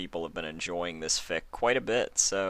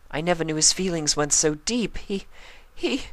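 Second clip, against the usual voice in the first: higher, fragile voice that breaks at the end; Rarity confessing; 6 semitones higher than usual. Acted. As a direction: admit her guilt to her friends.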